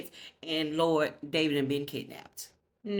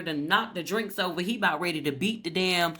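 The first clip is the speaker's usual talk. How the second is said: ordinary talk, nothing marked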